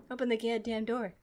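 low, soft grumble; subdued, fed-up command; quiet irritation. Real, not acted. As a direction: mutter it under your breath. First take, muttered to the game.

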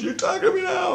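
very high shouted retort; squeaky mock shout. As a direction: snap back up high. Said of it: "He goes".

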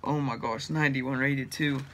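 ordinary delivery

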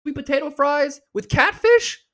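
can't believe what you just heard; incredulous outburst. Real, not acted. Outraged at the menu choice.

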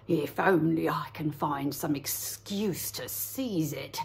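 low, slow wolfish growl; a low hungry wolf. Acted. Wolf thinks aloud.

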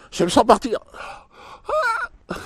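high panicked breathless pleading; hammed-up suffocation, gasping; gasping for air. Mock suffocating, pitch up.

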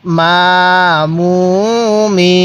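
"মা, মু, মি" each held long and level; measured syllable chant of "মা, মু, মি"; drawn-out vowels. Shown as a demonstration. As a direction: stretch "মা, মু, মি" with pauses between. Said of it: "এক আলিফ টানের নিয়ম".

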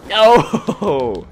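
high piercing scream of no; anguished yell. Real, not acted. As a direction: howl it as you die. Gets killed suddenly.

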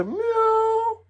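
single high squeaky meow; cat meow. Pretend. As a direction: pass yourself off as a cat. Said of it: "And me have to say".

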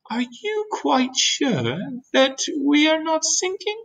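very high, slow ladybug question; doubtful ladybug. Acted. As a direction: ask it anxiously, drawn out and high.

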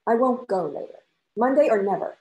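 loud, final refusal; firm. A narrator's reading. Woman refusing to delay the trip, loud and firm. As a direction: put your foot down hard. Heated lovers' quarrel.

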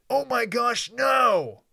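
high shrieking cry of fright; frightened yell. Acted. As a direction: scream it in sheer panic. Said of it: "Omelette yelled in a fright".

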